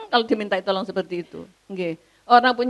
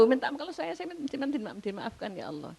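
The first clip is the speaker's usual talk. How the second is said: much softer acted plea; pleading prayer; 5 semitones higher, 11 dB quieter, faster than usual. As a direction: beg forgiveness under your breath. Acting out a plea for forgiveness. (memohon ampun dengan suara pelan)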